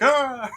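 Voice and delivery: short, high, loud mock scream; mock falling scream. Game master imitating a player plunging twenty feet, mock scream. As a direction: cry out as if suddenly dropping further. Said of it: "You're like".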